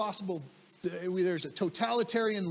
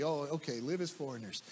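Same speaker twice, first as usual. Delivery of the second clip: casual shrug; 6 semitones lower, 8 dB quieter than usual. Retold or imitated. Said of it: "nod our head and say".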